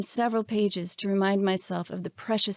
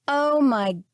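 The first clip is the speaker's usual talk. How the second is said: emphatic; much slower than usual. A narrator's reading. Narrator teasing the bored Caesar, emphatic mock pity. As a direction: tease him with big mock sympathy. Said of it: "I said emphatically".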